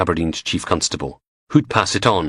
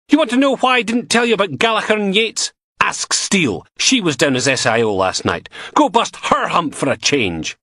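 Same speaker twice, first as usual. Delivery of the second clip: angry outburst; 11 semitones higher than usual. Acted. Logan blowing up at both bosses in anger. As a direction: finally blow your top.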